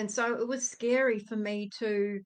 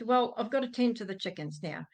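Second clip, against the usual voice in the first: ordinary delivery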